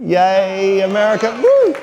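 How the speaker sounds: high, drawn-out yay and whoop; mock patriotic cheer. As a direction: playfully cheer for your adopted country, ending in a high woo. Mock patriotic cheer as new American.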